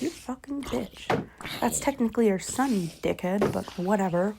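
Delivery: half-hearted mock outrage under the breath; muttered insult. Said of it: "I'm like".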